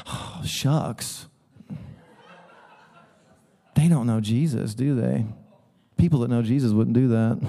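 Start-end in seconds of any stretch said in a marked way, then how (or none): 0.1-5.2 soft, half-whispered fake sympathy; mock pious pity. Pastor mocking smug Christians, whispered fake pity. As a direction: tut like a sanctimonious believer.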